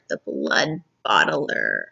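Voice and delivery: deep giant voice. Shown as a demonstration. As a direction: announce the title like a monster. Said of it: "Now we're on chapter called".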